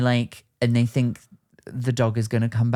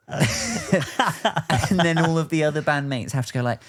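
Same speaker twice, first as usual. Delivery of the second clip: ordinary delivery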